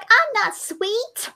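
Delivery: high, slow pouty denial; indignant muffin. Pretend. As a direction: pout it out slowly and high. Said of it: "it's just like".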